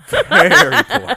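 high, loud, slow laughing repeat. Shown as a demonstration. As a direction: stretch it out through a laugh.

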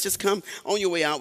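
usual speaking voice

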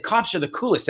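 put-on talking to a child. Coaxing a child toward police.

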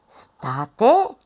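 high, loud startled cry of a village woman; frightened gasp. Acted. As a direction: shriek it out in alarm, hand to mouth. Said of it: "făcu femeia ... înfricoșată". (femeia strigă speriată, tare și subțire)

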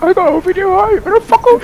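very high mimicked yelling; mock shout. Friend copying her video rant, high mock shout. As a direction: squeal it out like a mock tirade. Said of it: "like, the way I shouted".

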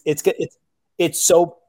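usual speaking voice

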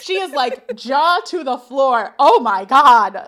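slow, loud, stretched gasp of shock. Retold or imitated. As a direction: mime a jaw-dropping extra.

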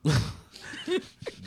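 breathy rising laugh. Real, not acted. Guest reacts to advising a kid, airy laugh.

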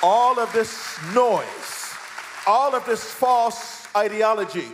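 high, loud fiery preaching, slow on all of this noise; preaching at full force. Acted. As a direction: thunder it from the pulpit.

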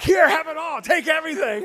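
loud, high, eager surrender; hammed-up eager caving; eager giving in. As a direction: blurt it out like you'd pay anything. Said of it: "I'm like".

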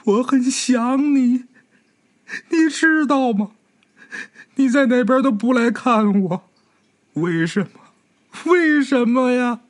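high, slow, tearful pleading; slow high grieving voice of the girl; crying girl. A narrator's reading. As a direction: plead with the one who is gone, near sobbing. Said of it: "一边哭一边说".

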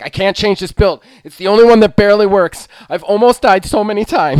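loud, high, frantic rushed pleading; sweating it out, terrified of losing the run; retelling his own gaming panic, hammed-up and loud; mock panic.

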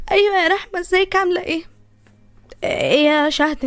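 normal talking voice

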